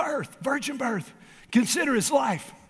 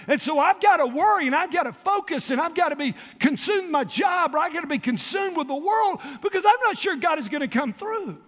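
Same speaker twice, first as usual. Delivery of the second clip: preaching shout; 7 semitones higher than usual. Acted. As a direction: push the worrier's logic hard. Preaching at full force.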